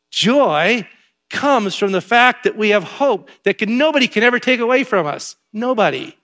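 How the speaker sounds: loud, high preaching build. Acted. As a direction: preach it to the back row. Preaching rise.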